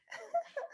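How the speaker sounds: high squealing laugh. Real, not acted. Laughing with the host, high squeal. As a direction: squeal with delight.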